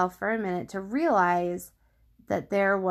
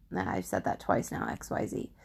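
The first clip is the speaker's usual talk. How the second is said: breathy whispered side comment, much lower; whispered aside; 13 semitones lower, 6 dB quieter than usual. Real, not acted. Podcast host whispering an aside to listeners, hushed. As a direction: lean in and whisper a quick aside.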